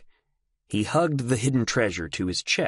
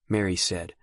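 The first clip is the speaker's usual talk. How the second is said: ordinary delivery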